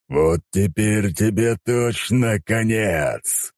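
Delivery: snarling deity's threat; menacing growl. A narrator's reading. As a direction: growl the threat out slowly, stretching the words. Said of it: "прорычал он".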